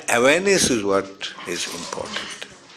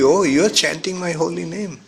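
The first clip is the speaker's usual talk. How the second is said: a light, higher voice of a pleased Krishna; happy Krishna; 6 semitones higher than usual. Acted. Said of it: "Krishna will be happy".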